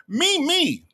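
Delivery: very high, playful name call; high-pitched call-out. Pretend. Calling out a viewer's name.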